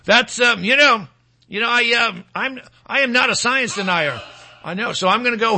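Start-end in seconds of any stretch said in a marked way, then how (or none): none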